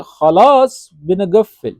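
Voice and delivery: slow demo. Shown as a demonstration. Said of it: "और अरबी में बोलेंगे".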